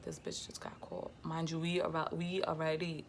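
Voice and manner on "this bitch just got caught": caught-you whisper. Retold or imitated. Discovering the cousin's messages.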